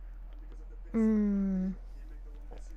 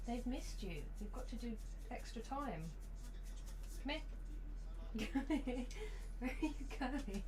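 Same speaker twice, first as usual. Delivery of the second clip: soft, high cooing to the dog; gentle sing-song dog talk; pet talk; 4 semitones higher, 10 dB quieter than usual. Real, not acted.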